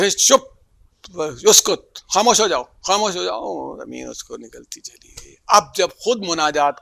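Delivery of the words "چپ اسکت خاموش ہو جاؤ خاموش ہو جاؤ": angry shout. Retold or imitated. Moses silencing Qarun in anger, loud furious command. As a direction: shout him down in a rage. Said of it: "تو غصے میں اس کو کہتے تھے".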